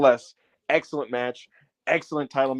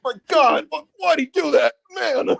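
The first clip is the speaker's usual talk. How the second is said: very high, half-sobbing lament; mock crying commentator; 8 semitones higher than usual. Excalibur imitated near tears at the tiger driver, very high. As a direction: ham up a weeping announcer. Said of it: "Excalibur's like".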